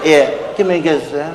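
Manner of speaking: usual speaking voice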